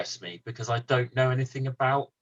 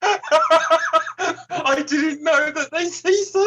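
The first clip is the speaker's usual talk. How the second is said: slow, high talk broken by laughter; laughing through words; 5 dB louder, much slower than usual. Real, not acted. Reacting to the quoted film line, laughing through the words. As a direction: say it while still laughing hard.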